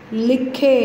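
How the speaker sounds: लिखे split towards a held khe; clear khe ending. Shown as a demonstration. Teacher modelling for pupils, लिखे ending in a clear long e. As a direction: sound out लिखे, leaning on the final khe. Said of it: "खे की आवाज़ आ रही है".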